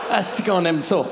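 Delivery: ordinary talk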